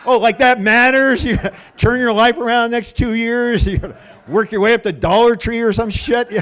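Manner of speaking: loud, high sarcastic outburst; worked-up, scornful comeback; yelling like maniac. Retold or imitated. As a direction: jeer back at full voice. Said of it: "I'm yelling at her like a maniac".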